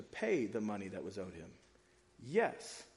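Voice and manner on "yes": ordinary talk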